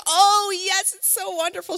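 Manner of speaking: high, loud gushing grandma voice; excited mom. Retold or imitated. Mom thrilled to feed the baby, gushing high. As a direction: gush with delight, a pitch up. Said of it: "She'd be like".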